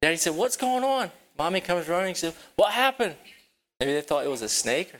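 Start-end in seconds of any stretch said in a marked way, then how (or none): 0.4-1.1 high, startled father's call; alarmed daddy. Acted. 2.6-3.1 high, startled mother's question; alarmed mommy. Acted.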